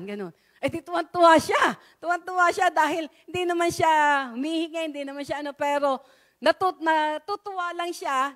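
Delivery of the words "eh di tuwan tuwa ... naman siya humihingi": excited telling. Real, not acted. Excited telling of her joy.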